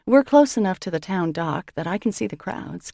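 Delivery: ordinary delivery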